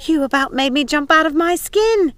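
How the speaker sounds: jumpy exclamation; shaken-up outburst; startled. Acted. Startled by Pickle.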